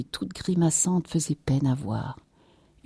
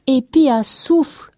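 high, loud rustic interjection; emphatic servant; 9 semitones higher, 7 dB louder than usual. A narrator's reading. La Louise backing up her master, emphatic. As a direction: chime in insistently, pitched well up. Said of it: "appuya la Louise".

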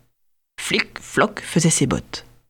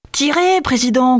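high, urgent yelling call; hunters' shout; 8 semitones higher than usual. A narrator's reading. Hunters urge the president to fire, shouting high. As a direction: cry out for him to shoot. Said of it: "crièrent ses amis chasseurs".

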